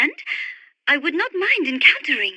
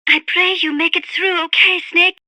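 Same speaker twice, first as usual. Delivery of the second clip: usual speaking voice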